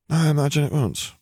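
high start falling away like a sigh; sighed resignation. A narrator's reading. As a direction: sigh the words out, conceding. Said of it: "Harry sighed".

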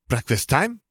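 excited little cheer. A narrator's reading. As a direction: cheer it lightly, lifting high at the end. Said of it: "Gabby cheered".

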